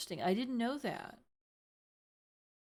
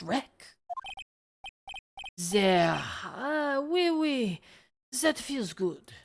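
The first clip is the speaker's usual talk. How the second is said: put-on indulgent French accent with oui oui; french chef; 4 dB louder than usual. Chef rubs oil.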